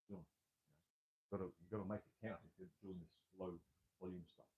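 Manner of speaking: distant and faint, far from the fallen mic; talking on while the mic lies on the floor. Real, not acted. Lifter keeps chatting after mic drops, sounding far away. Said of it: "There goes the, the mic".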